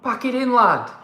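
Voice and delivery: high, loud catchphrase yell; hammed-up British scolding, rising loud; mock shout. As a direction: call it out like telling someone off. Performs the catchphrase for effect.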